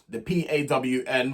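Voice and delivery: P A W N spelled letter by letter, slowly. Shown as a demonstration. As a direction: spell out P A W N instead of saying the word. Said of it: "that's why I'm spelling it out".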